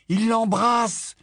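slow high insistent words; emphatic. A narrator's reading. Boy making sure the adults understand, slow emphatic high. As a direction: spell it out slowly and loud. James stressing his point.

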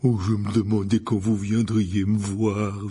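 slow, thick giant's voice, pleased; lumbering, friendly gamekeeper; Hagrid. Acted. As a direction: welcome visitors in a big, unhurried voice.

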